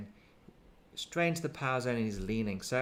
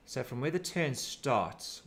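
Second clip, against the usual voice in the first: ordinary talk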